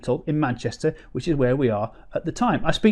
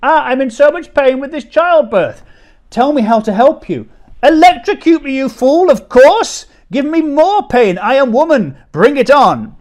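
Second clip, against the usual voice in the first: much higher, louder hammed-up wife; husband parodying wife in labour demanding shocks; mocking labouring wife; 10 semitones higher, 8 dB louder than usual. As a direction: lampoon the wife begging for pain. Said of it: "from a man's perspective".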